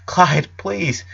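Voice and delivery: put-on a boy pleading with his panicking friend. A narrator's reading. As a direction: beg him gently to stop. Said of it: "Lincoln pleaded".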